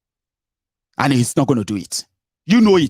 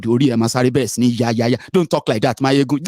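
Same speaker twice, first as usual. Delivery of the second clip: ordinary delivery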